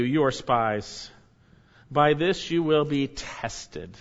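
very slow, deliberate sentence; stern slow verdict. Acted. Joseph sentencing his brothers, slow and stern. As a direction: pronounce judgment slowly.

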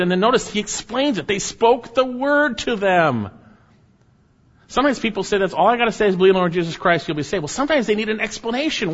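high-pitched, forceful pulpit delivery; impassioned preaching. Real, not acted. Preacher urging that the word be explained, impassioned preaching.

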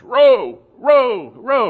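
loud, high barked rowing command; shouting row at the oarsmen; ship master's shout. Retold or imitated. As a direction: call the stroke to the rowers. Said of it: "the cry of a ship's master to its rowers".

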